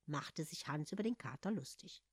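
usual speaking voice

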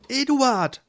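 high, eager call; bursting to tell her husband; excited wife. Acted. Marleen calls her husband with news, excited.

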